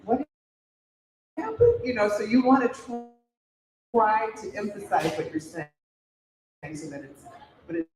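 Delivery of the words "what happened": rising, baffled stammer climbing very high; lost viewers who can't follow, stumbling then shooting up; mock bewildered viewer, pitch soaring on happened; confused viewers. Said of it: "people are like".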